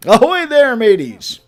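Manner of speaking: loud, high "Ahoy there" pirate hail; pirate greeting. Acted. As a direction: hail them like a jolly pirate. [name] greets pirate style.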